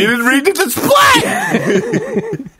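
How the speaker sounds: high, slow, laughing mock shout; laughing mockery. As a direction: cackle it out while mocking the attendant. Mocks attendant, laughing.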